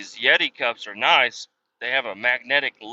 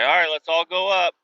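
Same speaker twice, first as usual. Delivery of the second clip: mock instructor; 6 semitones higher, 4 dB louder than usual. Mocking the training instructor.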